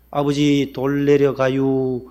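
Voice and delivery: each word dragged out slow, Chungcheong style; very slow drawl. Retold or imitated. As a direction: stretch the warning out far too slowly. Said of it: "얼마나 늦게 했는지". (돌 내려간다고 아주 느릿느릿 늘어지게)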